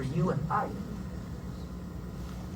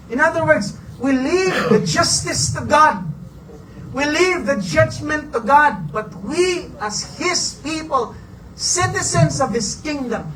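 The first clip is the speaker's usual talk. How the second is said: rising preaching; 6 semitones higher than usual. Acted. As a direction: lift the congregation with conviction. Preacher rises to full force.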